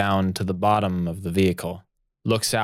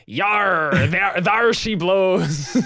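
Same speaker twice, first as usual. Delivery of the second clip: high, slow hammed-up pirate; 8 semitones higher, much slower than usual. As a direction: drawl it like a cartoon pirate. Fandango pirate voice.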